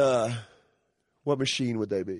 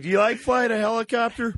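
high, bright interviewer question; mock interviewer; 11 semitones higher, 4 dB louder than usual. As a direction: ask it like a TV host.

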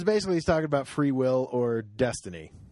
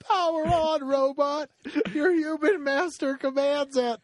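much higher, slow silly command voice; 13 semitones higher, slower than usual. Pretend. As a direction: boss the robot around in a high voice.

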